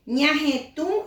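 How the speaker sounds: Ñaje tú with a nasal ñ; slow, nasal ñ in Ñaje tú. Shown as a demonstration. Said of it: "en vez de".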